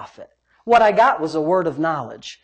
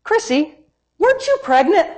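retold question; 9 semitones higher than usual. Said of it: "and I said".

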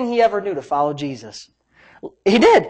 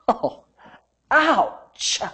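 mock ouch; 4 semitones higher, 4 dB quieter than usual. Preacher reacts to the stinging rebuke.

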